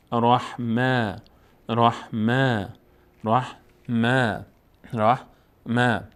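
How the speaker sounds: "رحمه" very slowly, last vowel held long and thin; long alef vowel. Shown as a demonstration. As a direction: stretch the end of "رحمه", syllable by syllable. («رحمه» شمرده، با کشیدن صدای آخر)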